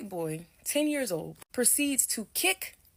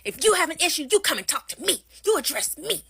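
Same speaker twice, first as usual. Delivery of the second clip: raised, indignant scolding, higher; mock angry yell; 5 semitones higher than usual. Mom yelling at the other mom, mock angry. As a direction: imitate a mom snapping back, pitched up. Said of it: "goes over and yells".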